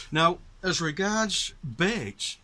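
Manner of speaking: usual speaking voice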